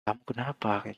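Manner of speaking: a close friend asking softly, face to face; soft heart to heart. Shown as a demonstration. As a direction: lean in and ask softly what's wrong.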